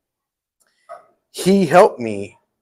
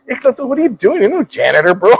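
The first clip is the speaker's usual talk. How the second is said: surprised Mencia; 9 semitones higher than usual. Retold or imitated. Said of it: "he goes".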